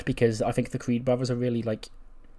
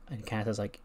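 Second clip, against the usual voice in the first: ordinary delivery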